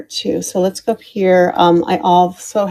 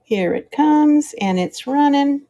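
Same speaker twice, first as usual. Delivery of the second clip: high drawn-out announce; 8 semitones higher than usual. Real, not acted. Announcing the auction going live.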